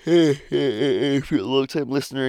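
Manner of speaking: slow words through a laugh. Real, not acted. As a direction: chuckle through it. Laughs after rant.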